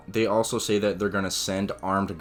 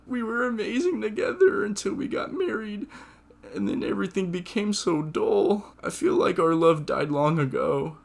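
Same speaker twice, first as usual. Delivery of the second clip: grieving mother; 13 semitones higher than usual. Retold or imitated. Mike's mom confessing her marriage.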